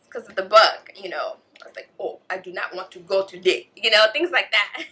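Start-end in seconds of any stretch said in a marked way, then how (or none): 2.0-3.7 a light, hammed-up Nigerian accent; bad Nigerian accent. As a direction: playfully imitate a Nigerian accent, badly. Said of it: "bad, you know, Nigerian accent".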